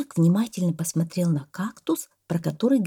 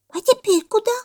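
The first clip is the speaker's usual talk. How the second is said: high, loud squeaky monkey question; puzzled little monkey piping up high; surprised monkey; 6 dB louder than usual. A narrator's reading. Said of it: "удивилась мартышка". (удивлённая мартышка)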